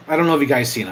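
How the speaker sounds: normal talking voice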